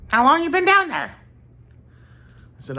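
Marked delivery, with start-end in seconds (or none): 0.1-1.2 very high, loud call; high-pitched put-on voice, loud; Bobby Justin. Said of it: "do a Bobby Justin impression".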